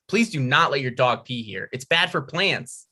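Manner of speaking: usual speaking voice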